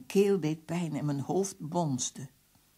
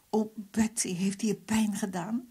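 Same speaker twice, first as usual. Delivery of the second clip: higher-pitched, anxious question; worried concern; 5 semitones higher than usual. A narrator's reading. Narrator asks injured Betsy if he hurt her, worried concern. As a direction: ask with pained concern.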